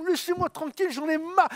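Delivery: high, fed-up outcry; fed-up cry. Acted. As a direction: cry out that you've had enough. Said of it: "lui criait".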